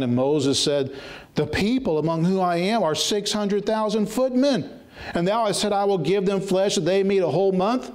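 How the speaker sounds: quick at first, then dragging out each phrase; fervent scripture reading. Acted. Preacher reading God's rebuke, rising and slowing. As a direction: build the verse like a sentence being passed. Reads Moses' complaint from scripture.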